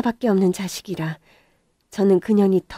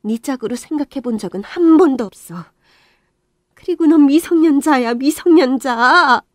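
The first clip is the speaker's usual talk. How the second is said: cold reproach; 7 semitones higher, 8 dB louder than usual. Acted. Mother telling her son the girl is a minor, scornful. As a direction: hammer the word home.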